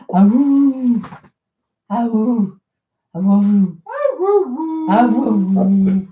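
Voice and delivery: little awoo howls for the dog; howling like a wolf pup. Shown as a demonstration. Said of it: "We're trying to get Moxie to talk for you".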